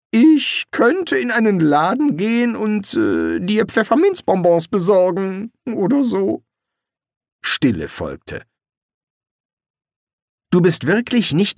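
high, hesitant bargaining; wizard voice. Acted. Rincewind haggles with the summoner, high and nervous. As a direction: offer something small, stalling.

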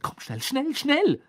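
high, loud hurrying call; urgent hurry. Acted. Hermine hurrying Harry along, high urgent calls. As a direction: rush them along, eager.

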